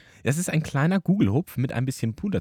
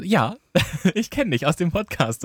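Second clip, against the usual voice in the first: excited trainee; 9 semitones higher than usual. Retold or imitated. Trainee's reply, voiced high.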